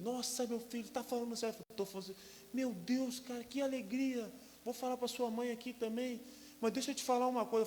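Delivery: high, loud joyful father's voice; overjoyed father. Retold or imitated. Father on the phone hearing of twins, overjoyed and loud. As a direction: burst with grandfather's joy.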